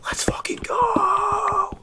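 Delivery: high, slow whispered cheer; hushed whisper cheer. Pretend. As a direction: whisper it high so the kids sleep. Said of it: "be quiet".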